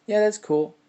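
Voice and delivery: high, loud eager okay that's cool; excited okay. Retold or imitated. Recalling his own thrill at the idea, high and loud. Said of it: "I was like".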